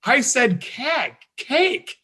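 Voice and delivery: put-on teacher explaining keg meant cake, in her accent; imitating Sharon's accent. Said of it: "it involves Sharon's accent".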